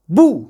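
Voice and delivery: بو said in one quick short burst; quick short vowel. Shown as a demonstration. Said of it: "بسرعة بقى". (بو بسرعة من غير مدّ)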